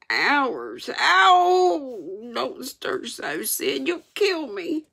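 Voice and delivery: high groaning wail; hammed-up moans of pain; feigned agony. A narrator's reading. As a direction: wail it like a suffering patient.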